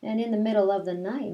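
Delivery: ordinary talk, nothing marked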